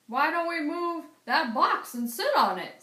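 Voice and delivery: a second voice, offhand suggestion; other man's voice. Acted. A dockworker suggesting they sit on the box.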